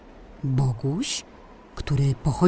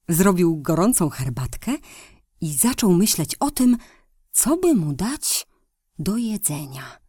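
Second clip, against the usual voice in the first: ordinary delivery, nothing marked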